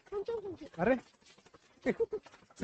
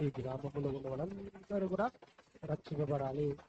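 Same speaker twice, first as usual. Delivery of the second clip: put-on slow, softer prayer line for the child to repeat; leading a prayer; 6 semitones lower, 6 dB quieter than usual. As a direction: pray it slowly so the child can follow. Adult leads prayer. (నెమ్మదిగా, మెత్తగా ప్రార్థన)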